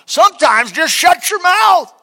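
high, loud slow shout; preaching shout. Real, not acted. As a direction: shout the point home. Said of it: "I'm preaching to me now".

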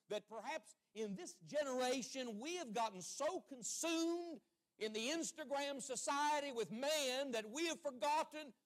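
slow, high-pitched preaching cry; impassioned preaching. Real, not acted. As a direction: hammer the point home from the pulpit. Preaches at full force.

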